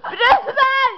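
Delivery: high, loud cry of protest; tearful shout. Real, not acted. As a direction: shout it through sobs. Begging friends to stop, crying.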